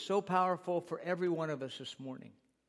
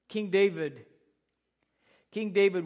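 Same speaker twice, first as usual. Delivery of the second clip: preacher calling out; 3 semitones higher, 6 dB louder than usual. Real, not acted.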